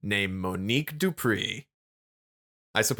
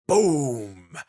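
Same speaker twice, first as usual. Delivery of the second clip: big drawn-out explosion word; punchy, raised "boom"; dramatic boom; 3 semitones higher, 4 dB louder than usual. Acted. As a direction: boom it out like a missile hit. Sound effect in story recap.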